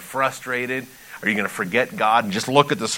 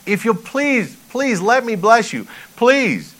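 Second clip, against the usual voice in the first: high, louder, insistent begging; pleading; 11 semitones higher, 5 dB louder, slower than usual. Acted. Pastor voicing God begging to bless his people, pleading. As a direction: implore them, leaning hard on each "please". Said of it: "he's pleading with".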